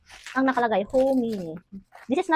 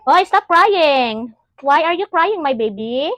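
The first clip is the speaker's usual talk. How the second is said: loud, drawn-out, higher-pitched call; 4 semitones higher, 6 dB louder, slower than usual. Real, not acted. Parent breaks off vlog to crying baby, calling out.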